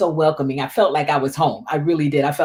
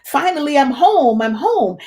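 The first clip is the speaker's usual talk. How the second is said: tearful relief; 10 semitones higher than usual. Retold or imitated. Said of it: "the tears just started coming out my eyes".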